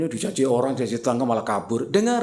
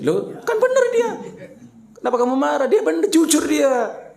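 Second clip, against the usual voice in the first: laughing high mock scolding; 5 dB louder than usual. Acted reply to a complaining wife.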